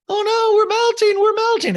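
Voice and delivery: shrieking, very high and loud; hammed-up wicked witch wail; melting witch. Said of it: "mocking the wicked witch".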